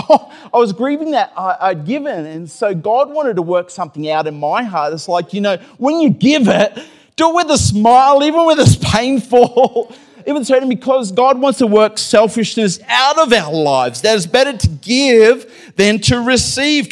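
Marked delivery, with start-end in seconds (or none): 5.8-16.9 raised high and loud, pushing the point; full-force preaching. Acted. Pastor urging the congregation to give, full-force preaching. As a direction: preach it out with a big rise.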